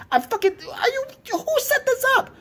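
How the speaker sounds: high, cracking voice, laughing and crying; strained furious laugh-sob; laugh-crying rage. Real, not acted. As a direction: rant until you break. Rant collapsing into laugh-cry.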